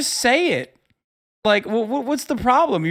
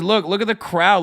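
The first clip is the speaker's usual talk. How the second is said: ordinary talk, nothing marked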